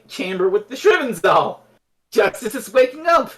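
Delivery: usual speaking voice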